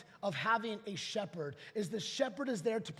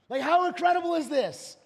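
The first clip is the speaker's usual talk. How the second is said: raised, ringing wonder; pressing the wonder home to the crowd; louder amazed repeat; 8 semitones higher, 11 dB louder than usual. Real, not acted. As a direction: say it again, bigger and louder. Preacher repeats with more force.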